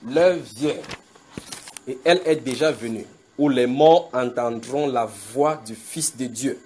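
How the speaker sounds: very slow, weighty reading of the verse; slow solemn recitation. Acted. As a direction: let each word of the verse land. Verse repeated very slowly.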